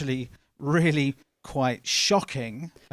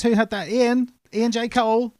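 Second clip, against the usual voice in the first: high, loud eager questions to chat; excited calling out; 11 semitones higher, 8 dB louder than usual. Real, not acted. Host excitedly asks the chat who sent the chocolates. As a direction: call out names, pitched high and loud.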